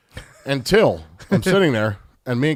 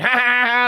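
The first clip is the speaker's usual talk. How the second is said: higher, mocking "ha, ha, ha, ha"; imitated family laughter; 7 semitones higher than usual. Storyteller mimics the whole family laughing, high mock laugh. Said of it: "all the whole family's like".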